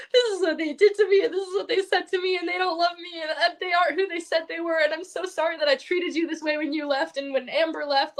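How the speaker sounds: high, whiny imitation of sobbing; bawling. Retelling her call to Samantha from the car, mock bawling. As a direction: wail it in one long tearful rush, mock-crying. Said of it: "I just started bawling".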